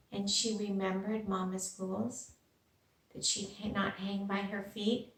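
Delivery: plain reading voice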